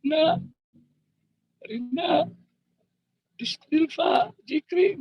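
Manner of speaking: high, anxious calls into the dark; injured father calling his wife and children after the crash, high and urgent; calling out names. Retold or imitated. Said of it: "saya panggil istri saya".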